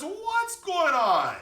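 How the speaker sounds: very high, loud, trumpeting call; huge drawn-out elephant blare; mighty elephant. A narrator's reading. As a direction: bellow it slowly like an elephant. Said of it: "this elephant raises his mighty trunk".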